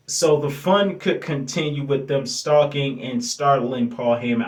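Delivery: normal talking voice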